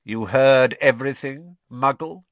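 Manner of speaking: cold voice. A narrator's reading. Hidden master confronting the eavesdropper, coldly. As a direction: menace from an icy master. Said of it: "said the cold voice".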